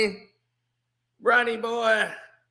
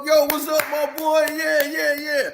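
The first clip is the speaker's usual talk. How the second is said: dap him up, then needle him; hammed-up buddy teasing teammate; imitating LeBron; 7 semitones higher than usual. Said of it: "LeBron is always cool, dapping him up".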